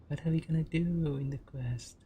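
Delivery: usual speaking voice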